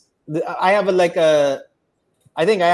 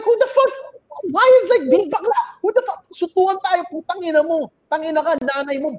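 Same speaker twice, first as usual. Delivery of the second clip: very high-pitched, indignant reenacted rant; fuming outburst; 12 semitones higher, faster than usual. Challenging the line cutter to a fight, retold fury. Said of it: "I'm fuming, I'm angry".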